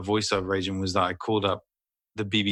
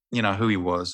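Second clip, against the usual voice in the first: normal talking voice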